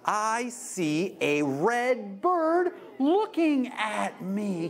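slow, sing-song picture book reading; read-aloud to kids. Shown as a demonstration. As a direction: draw out each line for little listeners.

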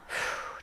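long, soft sigh out; demonstrative exhale. Shown as a demonstration. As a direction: breathe out audibly to let go. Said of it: "we're just going to exhale".

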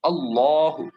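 আল্লাহু with a thick full-mouth lam; heavy lam in আল্লাহু, alːɔhu. Shown as a demonstration. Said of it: "পোর বা মোটা করে".

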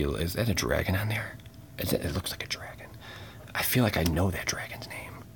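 hushed, fast whisper; quick whisper while squinting at the art. Real, not acted. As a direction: mutter it to yourself unvoiced. Peering at dragon art.

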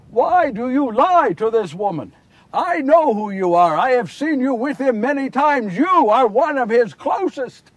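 confronting him, loud and forceful; loud accusation. Acted. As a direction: call out a liar in public. Man accuses Peter of lying.

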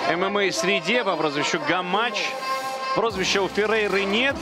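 normal talking voice

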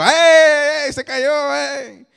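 high, loud playful cheering; excited cheer. Retold or imitated. Dad cheering for daughter after her fall, high loud cheer. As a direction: cheer her on so she laughs instead of cries. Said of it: "Y yo digo".

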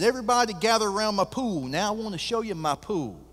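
high, loud, fast showman calling the crowd over; a big-talking rancher gathering everyone round; brash Texas billionaire announcing his pool; boastful billionaire host. Acted. Billionaire calls guests to pool.

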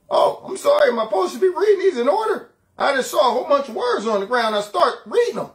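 high, loud mock apology; confused Joe impression. Host as Joe reading jumbled words, high mock bewilderment. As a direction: protest in a hammed-up, befuddled high voice. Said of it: "That's how he talks".

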